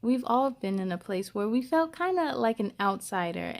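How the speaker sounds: ordinary delivery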